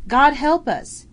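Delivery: loud, high, slow astonished bellow. Acted. Soldier bellowing to the man beside him, loud and slow. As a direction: bellow it over the gunfire. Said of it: "he bellowed to the man next to him".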